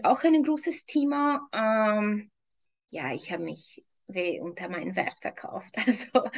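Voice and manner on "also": laughing at herself. Real, not acted. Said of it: "ich muss einfach selber lachen".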